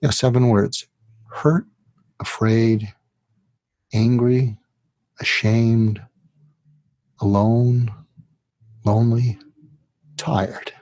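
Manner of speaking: yeah, seven words then each word alone with long pauses; hurt, afraid, angry, ashamed, alone, lonely, tired, slowly, pausing after each; slow, spaced list. Shown as a demonstration. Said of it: "And then you say it like this".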